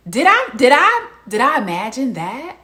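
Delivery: high, loud mock disbelief; sarcastic disbelief. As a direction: act as if you can't believe your eyes. Sarcastic rhetorical questions.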